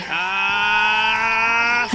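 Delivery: sustained, climbing "ah" yell; long battle-cry yell. Pretend. Playing at firing the toy shooter, long mock battle cry. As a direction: hold the attack cry and let it swell.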